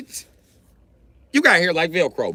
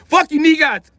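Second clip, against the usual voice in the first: angry mock rant; 10 semitones higher than usual. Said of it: "Africa got talking shit".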